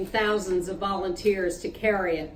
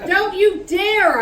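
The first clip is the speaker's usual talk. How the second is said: higher, louder, slow warning shout; a furious "don't you dare"; 8 semitones higher, 5 dB louder, slower than usual. Acted. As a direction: stop him cold, loud and slow. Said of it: "I shouted".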